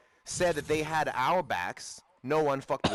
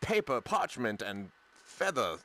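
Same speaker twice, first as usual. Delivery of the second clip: soft, low, lightly put-on genteel voice; mock refined; 3 semitones lower, 3 dB quieter than usual. Joking about the refined African guy, understated genteel impression. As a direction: pause delicately between items, imitating someone refined. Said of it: "more refined".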